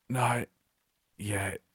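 choked, fading to almost nothing; a strangled killer gloating at her attacker, choked hiss. A narrator's reading. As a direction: squeeze the words out through a crushed throat. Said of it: "in a strangled hiss".

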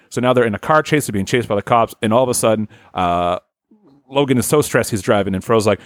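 normal talking voice

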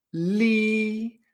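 "li" held high and level; first tone. Shown as a demonstration. Teacher applies first tone to another syllable, "li" high and flat. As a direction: sustain "li" on one high, steady note. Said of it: "lo utilizaremos con todas las sílabas".

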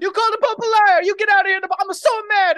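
high, loud indignant Pope; mock angry Pope. The Pope throws the knights out, loud and high. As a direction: play the outraged Pope.

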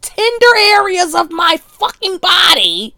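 sudden loud, high outburst of outrage; indignant shout. Real, not acted. Ranting to listeners about grooming, shouted in outrage. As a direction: yell it like you can't believe it.

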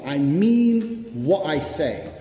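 every word slowed and leaned on; slow emphatic. Real, not acted. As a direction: drive it home slowly, word by word. Insisting he keeps his word.

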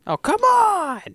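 very high, loud protest; mock outrage. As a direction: wail it in exaggerated disbelief. Mock outrage at principal's demands.

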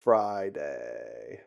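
creaky, low vocal fry. Shown as a demonstration. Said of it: "Vocal fry, dude".

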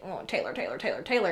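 soft, low grumbling imitation; muttered disdain. What everybody really said behind her back, muttered disdain. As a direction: drop volume and pitch, mock the name. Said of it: "everybody was actually like".

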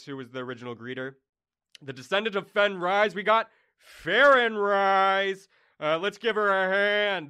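god of bliss introducing throne candidates; god emceeing. Acted. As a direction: present the candidates like a game-show host. God's announcer voice.

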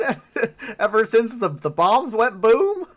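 high, loud mocking voice with a laugh; loud, high-pitched taunt; jeering game character.

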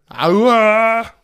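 high, loud drawn-out call; loud howl. Pretend. Host signals time for ask us anything, howling. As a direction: belt out a rising cry. Signature sound announcing a segment.

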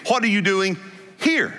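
weight the word "here" and lift it; stress on here. Shown as a demonstration. Preacher to congregation, stressing "here" in God's question. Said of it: "with the emphasis being".

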